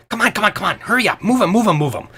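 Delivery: high, loud, insistent hurrying; pushy game character chivvying people; bossy, urgent Fabler. Acted. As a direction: rush them along with repeated urgent calls.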